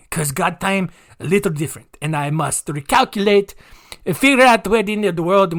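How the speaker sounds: mock Schwarzenegger accent. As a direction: preach in a jokey Schwarzenegger accent. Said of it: "it turned into Arnold Schwarzenegger there".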